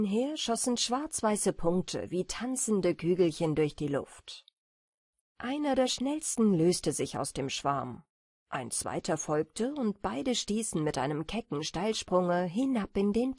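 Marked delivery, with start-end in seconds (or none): none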